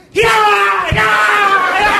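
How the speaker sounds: very high, loud yelling to scare off; shouting guide. Retold or imitated. Guide charging at the bear, very high loud shout. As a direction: yell to chase the bear away. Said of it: "he goes".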